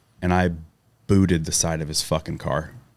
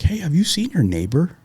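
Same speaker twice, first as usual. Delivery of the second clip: higher, lightly put-on cop asking; lightly voiced cop; 10 semitones higher than usual. The cop asking about the neighbor, lightly voiced question. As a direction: ask it like a casual officer.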